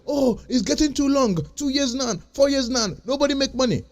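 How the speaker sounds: mock the complainers in a whiny voice; mock whining complaint. Mocking impatient crypto holders to his viewers, whiny imitation. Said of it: "And you are complaining".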